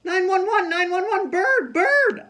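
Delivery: high, loud panicked screech; a girl screaming with a bird on her head. Acted. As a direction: scream for help at the top of your voice. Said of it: "I screeched".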